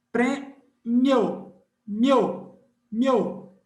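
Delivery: prêmio drawn out slowly, meu joined; teacher slows down prêmio to show the glide; one-movement demo. Shown as a demonstration. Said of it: "é um movimento só".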